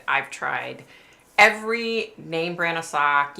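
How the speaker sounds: ordinary talk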